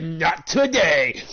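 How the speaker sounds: very slow, loud, drawn-out mock taunt; smug taunt. Opponent taunting him as the player escapes, slow loud smug taunt. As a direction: stretch each word out to rub it in. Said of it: "He's like".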